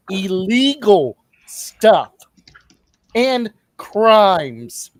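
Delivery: "illegal" drawn out and hit hard; slow, loud stress on "illegal" and "crimes"; emphatic restatement. Real, not acted. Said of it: "I'm gonna state it again".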